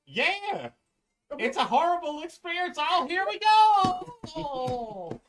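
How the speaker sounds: a pitiful squeak from a face stuck in a golem; squeaky trapped head. Acted. Head trapped in the golem's back, whining in a high squeak.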